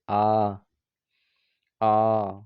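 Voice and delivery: आ held long with the mouth wide open; open vowel demo. Shown as a demonstration. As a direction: stretch आ out with the jaw dropped. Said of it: "मुख द्वार पूरा खुलता है". (मुँह पूरा खोलकर लंबा आ)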